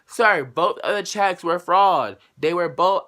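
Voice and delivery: a clerk snapping back at a customer. Retold or imitated. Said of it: "we're raising her voice".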